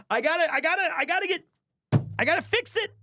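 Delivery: high-pitched squeaky fluster; mock panic. Mock panic, must fix his mood.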